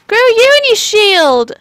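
drawn-out high yell of the ability; shouting. Real, not acted. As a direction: belt out the call, slowly.

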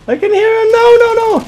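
very high, loud panicked shriek. Real, not acted. Gamer hears an enemy closing in, girly scream. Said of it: "girly screams forever".

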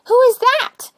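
high, loud call through the door; little rosebud demanding who knocks. Acted. Rosebud calls out.

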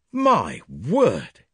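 loud, high exclamation; cried out. A narrator's reading. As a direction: blurt it out, astonished. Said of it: "he cried".

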